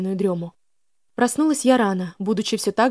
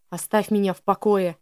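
usual speaking voice

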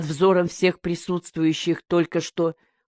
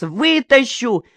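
high, drawn-out threat; desperate defiance; 6 semitones higher, slower than usual. A narrator's reading. Policeman feeling his end near, desperate defiance. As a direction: stretch the word out defiantly. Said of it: "чувствуя свой конец".

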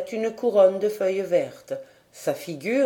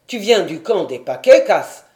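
chief calling out; 9 dB louder than usual. A narrator's reading.

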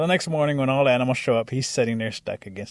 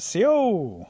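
high, louder hail into the dark; calling out a greeting; 11 semitones higher, 6 dB louder than usual. A narrator's reading. Rabbit greeting the unknown creature, calling out high. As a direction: call out, hoping for a reply. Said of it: "so rabbit says it again".

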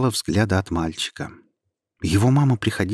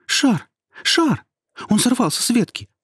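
excited; 7 semitones higher than usual. Acted. Boy excited about the ball.

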